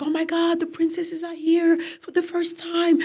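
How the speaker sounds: high, thrilled exclamation of welcome; excited greeting. Retold or imitated. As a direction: crowd members gushing over the princesses' arrival, excited. Villagers greet the arriving princesses.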